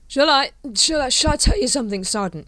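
put-on slurred, loud, rambling drunk captain; drunk Vimes. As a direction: play a tipsy officer waxing on.

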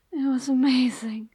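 ordinary delivery

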